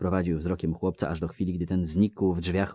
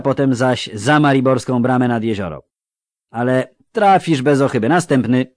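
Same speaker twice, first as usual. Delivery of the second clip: higher, louder clipped-off questions of a bored officer; brusque recruiter; 7 semitones higher, 12 dB louder than usual. Acted. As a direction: bark the questions like a tired recruiter. Officer dismisses Jare. (znudzony komisarz, głośno i wyżej)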